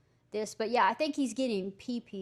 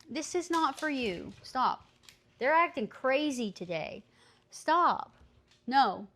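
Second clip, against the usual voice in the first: louder, higher scolding; scolding a dog; 3 semitones higher, 5 dB louder than usual. Real, not acted. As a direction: snap at the dogs. Scolding dogs over food.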